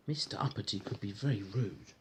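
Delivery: usual speaking voice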